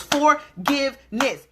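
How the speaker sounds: Forgiveness slowly, each syllable struck like a clap; syllables clapped. Shown as a demonstration. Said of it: "Hear that clap".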